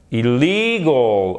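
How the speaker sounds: Illegal drawn out slowly, stress on the middle LEE; stressed second syllable. Shown as a demonstration. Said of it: "c'est l'accent tonique".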